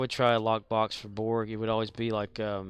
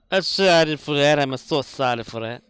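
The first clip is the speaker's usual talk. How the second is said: excited; 2 semitones higher, 8 dB louder than usual. Pretend. Loud mock excitement.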